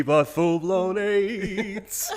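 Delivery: high, slow stressed full-blown aids; slow emphatic punchline. Acted. Punchline delivered laughing.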